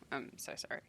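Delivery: whispered quick apology. Real, not acted. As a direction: breathe the apology under your breath. Apologizing aside.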